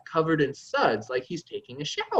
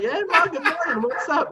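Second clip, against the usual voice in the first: high, innocent playing dumb; 11 semitones higher, 3 dB louder than usual. Son answering his mother, playing dumb in a high voice. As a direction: feign total innocence, voice lifted high. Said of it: "I'm playing dumb".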